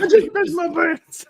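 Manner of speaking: very high squeaky mock panic; high mock whimper; panicked quote echoed. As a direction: squeak it like a scared wimp. Listener repeats the panicked quote.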